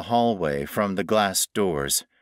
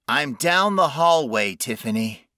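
higher, slightly raised call; 5 semitones higher, 3 dB louder than usual. A narrator's reading. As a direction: call down a long hallway.

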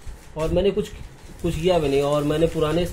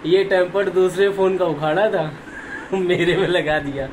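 loud, higher-pitched telling broken by short laughs; amused, laughing; 4 semitones higher, 3 dB louder than usual. Real, not acted. Vlogger telling viewers about the phone shop's trick, amused and laughing. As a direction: laugh between phrases as you tell it. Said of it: "मुझे बहुत हंसी आ रही है".

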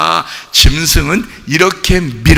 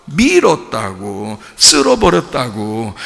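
normal talking voice

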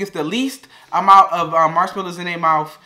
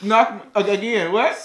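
ordinary talk

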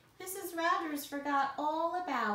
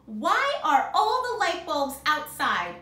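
slow, loud, higher-pitched calling to account; loud exasperated demand; 5 semitones higher, 11 dB louder, slower than usual. A narrator's reading. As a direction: boom it out like an upset employer. Mister Rogers confronts his maid in story.

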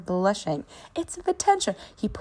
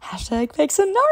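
announcer intro; 9 semitones higher, 7 dB louder than usual. Acted. Announcing a made-up story.

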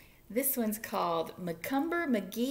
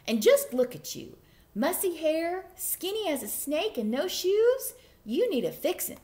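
bossy aunt; 6 semitones higher than usual. Acted. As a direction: fuss at him like a stern aunt.

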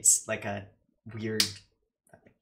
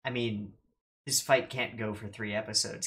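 ordinary talk, nothing marked

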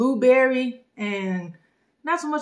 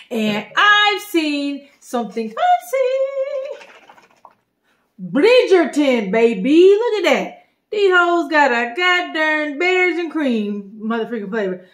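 higher, louder, drawn-out excitement; excited; 4 semitones higher, 4 dB louder, slower than usual. Real, not acted. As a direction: gush over the find like a giddy shopper. Excited reveal of Bridgerton drink.

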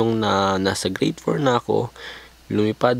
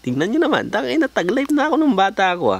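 mock boast; 12 semitones higher than usual. Mock proud brag.

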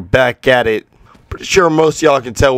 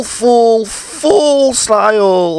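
stretched full; 10 semitones higher, much slower than usual. Acted.

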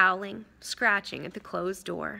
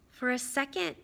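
plain reading voice

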